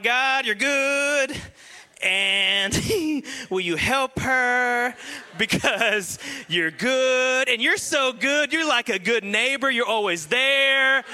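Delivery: mock prayer, dragging out each phrase while groping for words; hammed-up, drawn-out sing-song praying; nervous stalling prayer. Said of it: "I kinda blacked out because I got nervous".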